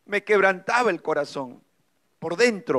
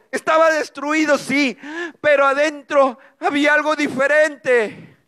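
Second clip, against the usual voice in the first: ordinary delivery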